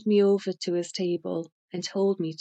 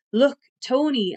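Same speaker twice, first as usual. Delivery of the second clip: insistent appeal; 6 semitones higher, 6 dB louder than usual. A narrator's reading. Narrator pleads with angry friend.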